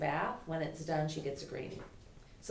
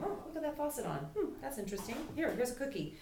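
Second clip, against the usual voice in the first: curious dog voice; 8 semitones higher than usual. Retold or imitated. Trainer voicing a dog curious about the running faucet, higher pitched. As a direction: play the dog noticing the faucet with wonder. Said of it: "they'll go".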